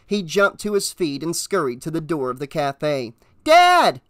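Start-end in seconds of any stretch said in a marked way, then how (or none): 3.4-4.0 put-on loud, high boy's yell; alarmed call, much higher and louder; shout. A narrator's reading. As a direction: yell for your father through a door. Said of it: "he shouted through the screen door".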